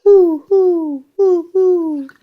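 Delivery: high, drawn-out hoots; owl hoot. A narrator's reading. Reader shows a child the owl, high hoots. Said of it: "He can sound like an owl".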